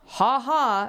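spoken, awkward fake ha ha; mock nervous chuckle in words; uncomfortable laugh. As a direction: imitate an uneasy polite laugh. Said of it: "just, like, this uncomfortable".

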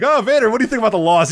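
high, loud mock interviewer question. Host mocking the ringside interviewer, high hammed-up question. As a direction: ham up the eager reporter. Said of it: "they're like".

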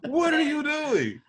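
high, slow laughing what are you doing; incredulous laughing outburst; laughing disbelief. Real, not acted. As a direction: laugh in shock at the post. Disbelief at friends' posts.

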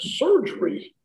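a sharp high jolt of disbelief on one word; shocked disbelief. Retold or imitated. Told he needs throat surgery, shocked high disbelief. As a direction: relive hearing the word surgery.

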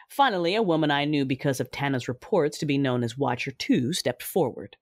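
usual speaking voice

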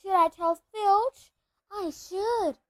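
high, slow mock-saintly voice; piously drawn-out teasing; Peeves, saintly. A narrator's reading. Peeves toys with telling Filch, falsely saintly and high. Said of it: "said Peeves in a saintly voice".

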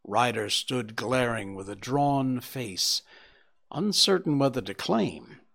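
usual speaking voice

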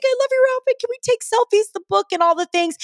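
put-on very high, loud, gushing girly voice; mock bubbly fan girls rushing up; gushing fangirls. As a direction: squeal it like an excited fan. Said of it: "and they're like".

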